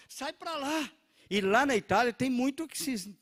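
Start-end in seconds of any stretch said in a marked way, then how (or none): 0.1-0.9 shooing lady. Retold or imitated.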